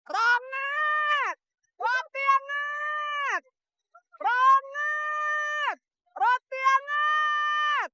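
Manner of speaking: high, drawn-out hawker's cry; street vendor's call. Acted. Bread seller hawking warm bread, calling out. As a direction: call out your wares in long held notes.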